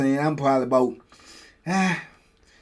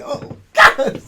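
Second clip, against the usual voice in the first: much higher frightened cry; terrified cry; 6 dB louder than usual. Retold or imitated. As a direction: gasp it out in terror. Seeing the dog come in.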